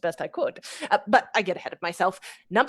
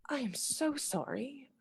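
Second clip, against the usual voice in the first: much softer, slow, hushed regret; soft regretful apology; 4 semitones higher, 11 dB quieter, slower than usual. Acted. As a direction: let him down gently. Refusing the suitor again.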